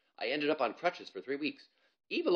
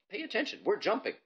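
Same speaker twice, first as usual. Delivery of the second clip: higher, louder call; impatient girl; 11 semitones higher, 4 dB louder than usual. Acted. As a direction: snap his attention back. Ivalo scolding Bob.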